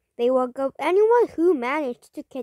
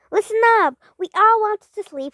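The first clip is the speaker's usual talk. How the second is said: higher, louder rallying call; calling for attention; 7 semitones higher, 6 dB louder than usual. A narrator's reading. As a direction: call a noisy group to order. Mouse addresses a noisy group.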